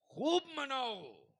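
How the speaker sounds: sudden high, loud exhortation; rousing call. Real, not acted. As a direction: swoop up loudly to urge the crowd on. (जोश से ऊँची पुकार)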